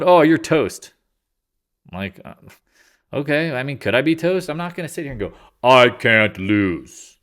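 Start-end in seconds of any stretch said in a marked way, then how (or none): 0.0-0.9 loud, high imitation of hecklers; mock taunting. Voices people taunting him. 5.6-7.2 loud, hugely drawn-out mock boast; mock bravado. Mocks an overconfident trader.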